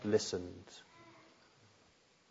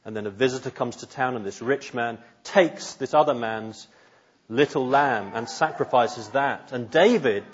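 usual speaking voice

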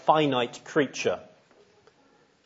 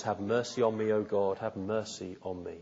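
softer, hushed prayer for mercy; humbled plea; 3 semitones lower, 8 dB quieter than usual. Retold or imitated. David praying after Nathan's rebuke, as a soft humbled plea. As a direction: plead quietly, head bowed.

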